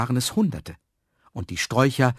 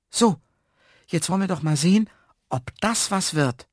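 higher character voice, eager to see; a touch of high-pitched anticipation; lightly voiced eager man; 8 semitones higher, 3 dB louder than usual. A narrator's reading. Man has just watered his seeds, higher expectant voice.

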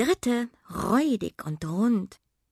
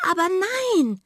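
startled cry; 13 semitones higher, 4 dB louder, much faster than usual. A narrator's reading. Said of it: "rief Miranda erschrocken".

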